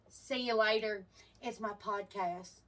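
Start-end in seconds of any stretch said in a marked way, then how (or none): 1.4-2.6 drowsy sign-off. Real, not acted. Sleepy sign-off.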